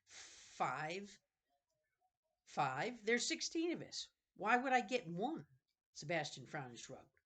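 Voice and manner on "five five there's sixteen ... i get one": incredulous. Acted. Jonathan incredulous reply.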